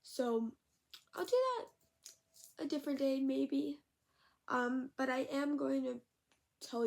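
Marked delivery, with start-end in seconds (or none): none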